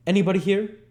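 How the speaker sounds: loud, high call into the dark. Acted. Sikes calls into dark.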